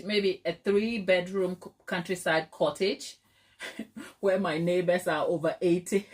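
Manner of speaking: ordinary delivery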